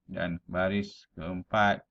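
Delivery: ordinary talk, nothing marked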